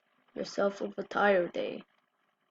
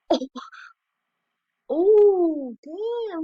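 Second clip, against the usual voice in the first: high, loud burst of surprise; shocked exclamation; 8 semitones higher, 8 dB louder, much slower than usual. Real, not acted. Sees a cat leap in a clip, high loud shocked yelp.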